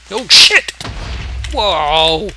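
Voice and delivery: loud, high shocked cry; real fright at the blast; startled yell. Real, not acted. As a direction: jump out of your skin. Creeper explodes suddenly.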